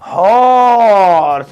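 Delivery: Horse spoken slowly and drawn out; slow motion. Shown as a demonstration. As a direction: stretch every sound of horse. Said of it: "slow motion में".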